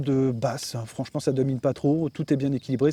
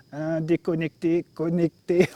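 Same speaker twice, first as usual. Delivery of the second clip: imitation traînante d'une annonce chinoise torturée; voix sous la torture; 2 semitones higher, slower than usual. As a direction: imite une voix de synthèse qui souffre, lentement. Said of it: "elle a fait ça sous la torture". (voix torturée, comme arrachée de force)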